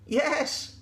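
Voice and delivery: high goat voice, affirming; Gladys the goat. Acted. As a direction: confirm it in a high voice. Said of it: "said Gladys".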